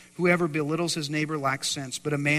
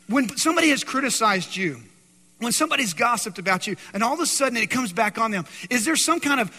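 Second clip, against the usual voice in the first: louder preaching; 9 semitones higher, 9 dB louder than usual. Acted. Preaching at full force.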